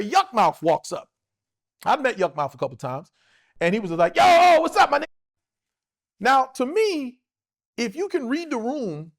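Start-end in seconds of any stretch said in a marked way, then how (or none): 4.2-5.0 loud, high-pitched hail to a buddy; big imitated "yo" holler; shouted greeting. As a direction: call out boisterously, pitch jumping up. Oblivious friend calling out a greeting.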